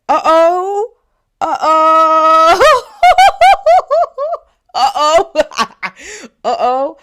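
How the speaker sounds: high, loud sing-song uh-oh broken by laughs; mocking uh-oh. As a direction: taunt him with squealing uh-ohs and cackles. Mocking reaction to his insult.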